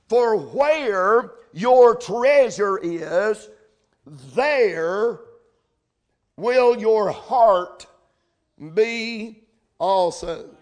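very slow, weighty reading; solemn text verse. Acted. Preacher giving the church its text verse, slow and solemn. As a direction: let each phrase of the text land.

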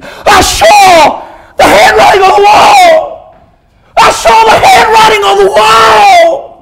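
high, drawn-out horrified scream; Belshazzar screaming. Acted. Doomed king crying from hell, horrified screaming. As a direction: wail it slowly at full force. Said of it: "There was Belshazzar screaming".

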